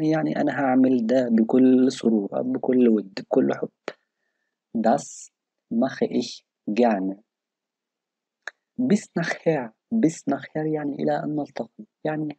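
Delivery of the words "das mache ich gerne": slow demo. Shown as a demonstration.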